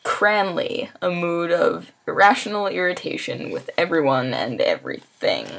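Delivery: crisp definition reading; reading aloud. Acted. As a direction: read each entry out clearly. Reads dictionary definitions aloud.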